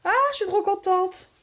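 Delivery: joie aiguë et spontanée; trop contente. Real, not acted. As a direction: laisser éclater sa joie. Ravie de son colis. (ravissement aigu et sincère)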